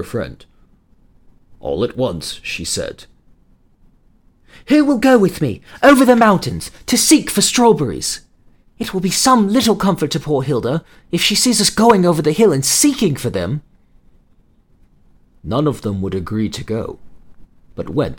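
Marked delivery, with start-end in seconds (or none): 4.7-13.6 put-on high, earnest little girl voice; rally the friends, bright and earnest; eager young girl. Delphine asks the other children.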